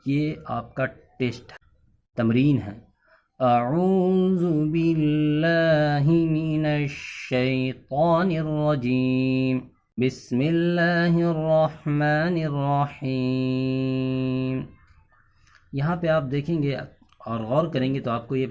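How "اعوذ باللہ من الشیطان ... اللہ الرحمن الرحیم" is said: very slow, melodic tajweed chant; Quranic recitation. Acted. Teacher recites the lesson verses, slowly chanted. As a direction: stretch every vowel in recitation.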